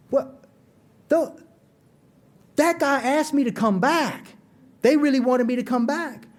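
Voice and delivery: high, halting, stunned disbelief; acted amazement. Man in the parking lot realizing he was wanted back, amazed.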